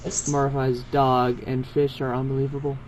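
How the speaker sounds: plain reading voice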